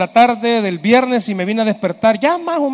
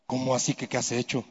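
low, hushed, quick baffled reply; retold timid comeback, very soft; meek husband; 7 semitones lower, 12 dB quieter than usual. Husband answering his cross wife, meek and hushed. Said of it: "Y le digo".

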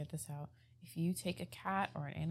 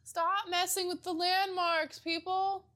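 high, slow exasperated plea; mock scolding; 10 semitones higher, much slower than usual. Telling satanic worshipers to leave landmarks alone, mock scolding.

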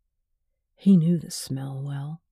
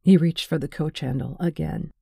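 plain reading voice

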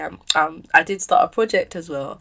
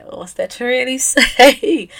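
laughing; 8 semitones higher, 11 dB louder, slower than usual. Real, not acted. Laughing while introducing herself.

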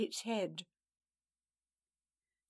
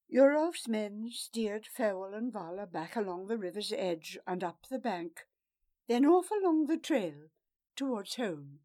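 plain reading voice

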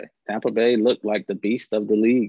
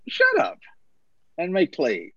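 exasperated scoff; 6 semitones higher, slower than usual. Real, not acted. Scoffing at Hurts' quote.

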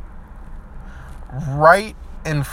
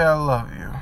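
soft, hushed, sincere words of care; quietly telling them you still care; tender forgiveness; 3 semitones lower, 8 dB quieter than usual. Pretend. To the person who hurt them, soft and tender.

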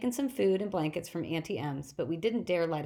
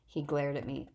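plain reading voice

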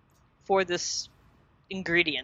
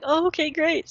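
high, bright-eyed okay great; high, gushing okay; chirpy excited reply; 10 semitones higher, 3 dB louder than usual. Retold or imitated. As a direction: imitate Rachel sounding thrilled. Rachel retold reacting to Ross.

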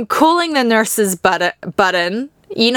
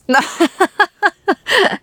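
teary laugh; 8 semitones higher than usual. Real, not acted. Emotional laugh mid story.

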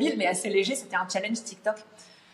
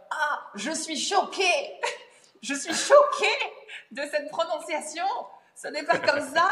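shocked commenter parody; 8 semitones higher than usual. Retold or imitated. Mocking a shocked online commenter over her British pronunciation, high and laughing. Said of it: "Ça va être".

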